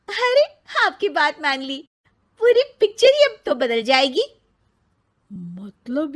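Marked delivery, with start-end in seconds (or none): 0.1-4.2 excited. Acted. TV delighted its advice was taken.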